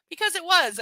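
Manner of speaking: loud, high, firm answer; emphatic confirmation. A narrator's reading. Boyfriend confirming to her, loud and emphatic. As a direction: confirm it forcefully without drawing it out. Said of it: "I confirm".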